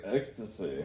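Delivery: a low, flat one-word answer; deadpan drug dealer. Retold or imitated. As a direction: toss it off, totally unbothered. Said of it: "he was just like".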